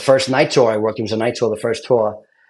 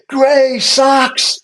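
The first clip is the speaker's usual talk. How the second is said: mock drill-instructor yell; 12 semitones higher, much slower than usual. Instructor yelling at a recruit over his gray socks, high mock rant.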